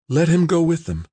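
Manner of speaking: high, pressing plea; urging. A narrator's reading. Tamara to Nobo on George's behalf, urging. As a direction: press him to give in. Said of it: "Tamara urged".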